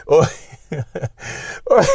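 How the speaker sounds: helpless real laughter, trying to go on; real giggling fit at own joke; cracking himself up. Real, not acted. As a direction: keep breaking into laughter between words. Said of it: "I make myself laugh".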